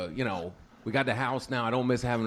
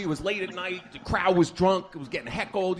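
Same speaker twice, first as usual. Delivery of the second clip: ordinary delivery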